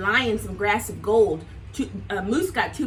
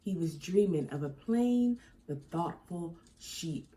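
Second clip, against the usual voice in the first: soft bedtime ending; 7 semitones lower, 7 dB quieter than usual. A narrator's reading.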